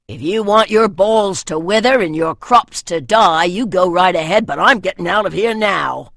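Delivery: loud, hurried warrior's refusal; a scared warrior telling the others off; frightened warrior. A narrator's reading.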